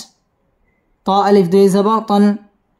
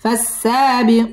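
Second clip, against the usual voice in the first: slow syllable blending; 3 semitones higher, much slower than usual. Shown as a demonstration.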